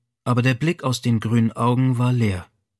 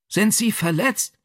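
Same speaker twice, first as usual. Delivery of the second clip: higher calling question to the injured driver; called out; 9 semitones higher than usual. A narrator's reading. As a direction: call down to the wrecked car, urgent. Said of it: "rief er".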